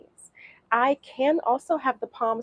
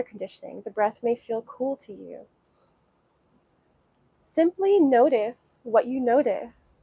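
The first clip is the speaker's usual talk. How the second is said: guided meditation. Acted. Teacher guiding beginners through breathing, calm meditation voice. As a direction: ease them into watching the breath.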